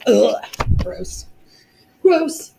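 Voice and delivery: disgusted groan, then a high gross; playful disgust at the topic; annoyed ugh. Real, not acted. Teacher groans at piecewise functions.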